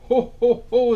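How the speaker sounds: a booming merry Santa; jolly Santa laugh. Acted. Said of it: "said Santa".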